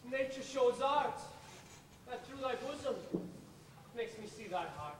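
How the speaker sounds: normal talking voice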